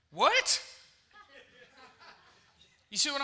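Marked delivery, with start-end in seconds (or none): 0.1-0.6 acted offended disbelief; shocked. Pastor shocked by misread text.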